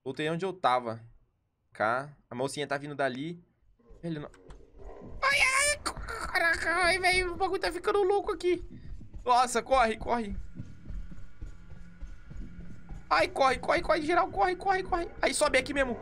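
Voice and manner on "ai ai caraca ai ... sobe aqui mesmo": being chased. Real, not acted. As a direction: scramble away screaming. Rat chasing, frantic escape. (correria desesperada)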